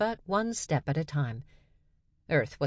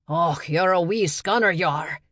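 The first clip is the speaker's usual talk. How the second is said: playful put-on Scottish brogue, louder; fake Scottish accent; 5 dB louder than usual. Lab assistant teasing Nala in a fake Scottish accent. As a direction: hams up a Scottish burr to tease. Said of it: "The accent was fake".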